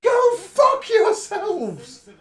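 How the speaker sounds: slow, high, loud furious curse. Real, not acted. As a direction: spit each word out slowly in fury. Ranting at Labour right.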